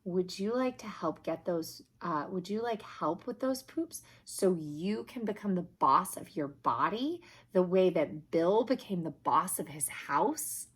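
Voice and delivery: ordinary delivery, nothing marked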